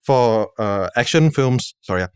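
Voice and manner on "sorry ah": normal talking voice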